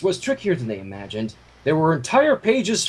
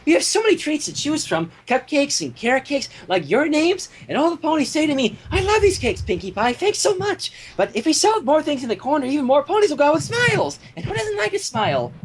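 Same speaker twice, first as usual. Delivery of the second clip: high, bouncy pony chatter; Pinkie Pie voice; 9 semitones higher than usual. A narrator's reading. Pinkie pitching her idea to the Cakes, high and bubbly. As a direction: keep it sunny and sweet.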